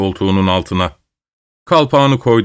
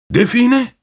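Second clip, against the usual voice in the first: high, sudden cry of discovery; excited cry; 12 semitones higher than usual. Acted. Grandfather spotting a candle over a grave, crying out in excitement. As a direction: blurt it out, eyes lit up at the find. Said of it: "diye haykırdı dedem".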